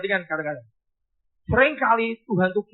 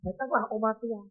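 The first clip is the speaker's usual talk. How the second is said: ordinary delivery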